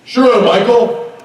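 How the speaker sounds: booming, over-eager acted quote; John Schneider. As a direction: raise pitch and volume for a big obliging reply. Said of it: "He's like".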